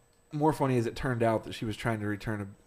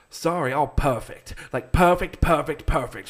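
loud, higher-pitched British caller imitation; mock British accent; 4 semitones higher, 7 dB louder, slower than usual. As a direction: hammer out the word in exaggerated British. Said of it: "She was seriously talking like that".

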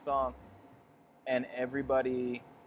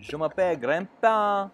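mock French grandpa; 7 semitones higher, 4 dB louder than usual. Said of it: "work up this grandpa character".

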